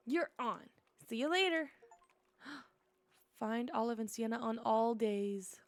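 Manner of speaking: normal talking voice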